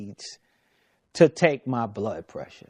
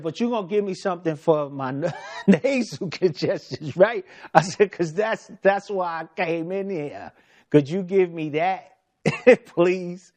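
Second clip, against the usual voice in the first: laughing plea; 4 semitones higher, faster than usual. Retold or imitated. Patient pleads with doctor for congestion medicine, laughing. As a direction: chuckle while begging for something.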